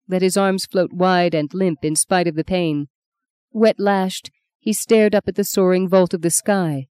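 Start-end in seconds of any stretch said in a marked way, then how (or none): none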